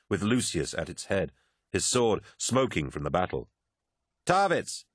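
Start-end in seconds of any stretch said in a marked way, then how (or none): 4.3-4.9 high-pitched hail; calling out. A narrator's reading. Swordsman hails his comrade across the palace roof, calling out. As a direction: call the name out high, falling off at the end. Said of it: "called Lucius".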